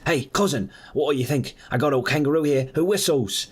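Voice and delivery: high, proud Aussie voice; Australian accent. Acted. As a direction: show off excitedly in a put-on Australian accent. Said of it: "his cousin isn't Australian".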